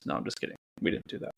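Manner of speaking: hushed, almost whispered, quick; quiet aside. Real, not acted. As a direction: drop to a murmur to take it back. Joking aside taken back.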